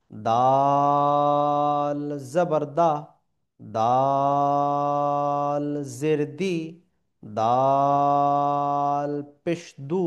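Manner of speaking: very slow, loud, long held chant; drawn-out recitation. Shown as a demonstration. Teacher chanting the dal drill slowly and loudly. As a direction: stretch every syllable in a ringing chant.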